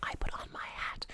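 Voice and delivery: soft whisper with no voice at all; toneless whisper. Shown as a demonstration. Coach showing the mistake of a toneless whisper. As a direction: whisper with no tone, the wrong way. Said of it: "You don't wanna be sort of".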